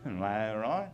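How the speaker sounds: low, soft British murmur; mock British bedroom voice. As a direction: murmur in a put-on British accent. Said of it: "Must be weird in bed".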